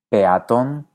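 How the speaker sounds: PEATÓN with E and A apart; hiatus. Shown as a demonstration. Teacher asks which you say, peatón in hiatus. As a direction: say pe-a-tón in three syllables. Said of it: "Tiendes a mantenerlas separadas".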